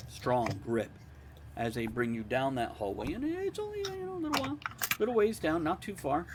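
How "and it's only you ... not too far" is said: high squeaky aside. Acted. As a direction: squeak it out, much higher. Narrator drifts into a high squeak.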